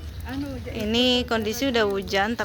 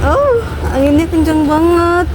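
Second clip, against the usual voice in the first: shouting over wind; 6 semitones higher, 10 dB louder than usual. Real, not acted. Windy upper floor.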